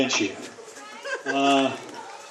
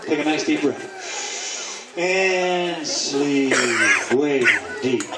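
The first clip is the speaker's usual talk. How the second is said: slow, drawn-out hypnotist commands; hypnotic induction; 3 semitones lower, much slower than usual. Real, not acted. Hypnotist putting the seated volunteers to sleep, slow hypnotic induction. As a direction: ease them under, stretching out the words.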